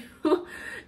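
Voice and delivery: short airy laugh; a quick unvoiced laugh; breathy laugh. Real, not acted. Laughs at failed vlog night.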